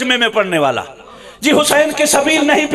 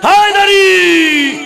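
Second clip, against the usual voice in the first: long, high shouted call; rallying cry; 5 semitones higher, 5 dB louder, much slower than usual. Real, not acted. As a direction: stretch the cry out to rouse the crowd. Crowd chant.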